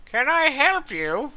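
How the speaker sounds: high, slow servant's question; prim high butler voice; snooty butler. Acted. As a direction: ask like a stiff old butler. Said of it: "and he's like".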